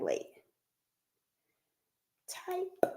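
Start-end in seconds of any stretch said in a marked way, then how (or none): 2.3-2.9 whispered single word; a secretive whisper to the chat. Real, not acted. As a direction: whisper it so the community can't hear. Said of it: "Can't say it out loud".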